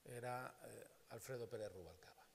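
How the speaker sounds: usual speaking voice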